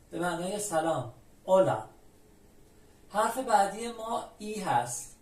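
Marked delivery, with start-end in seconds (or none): 1.4-1.8 اولا opening straight on o, no h; silent h. Shown as a demonstration. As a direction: say اولا loudly with the h left silent. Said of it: "هیچ تلفظی نداره". («اولا» بدون صدای ه)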